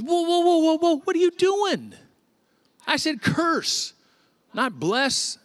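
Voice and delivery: outraged shout. Acted. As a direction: yell to stop him mid-blessing. Angry king stops Balaam's blessing.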